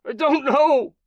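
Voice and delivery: very high, loud scared denial; pleading through pain; high frightened cry; panicked denial. Acted. Beaten captive denying, bloody nose.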